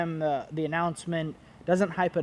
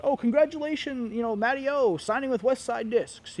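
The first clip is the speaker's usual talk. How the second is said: bright, high mock-cheerful announcement; mock cheery congratulations; 8 semitones higher than usual. Vlogger mocking a brand that spoils a signing, high mock cheer. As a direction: sing out a fake congrats, higher up.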